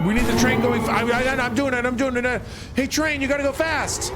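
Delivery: panicked. Real, not acted. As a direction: scramble in a panic. Panicking over train speed.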